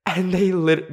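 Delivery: normal talking voice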